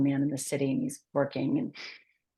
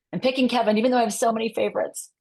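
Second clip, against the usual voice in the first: louder, higher and quicker with real enthusiasm; excited; 6 semitones higher, 6 dB louder, faster than usual. Real, not acted. Guest eagerly choosing her favorite founder story, excited. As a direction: burst out happily with your pick.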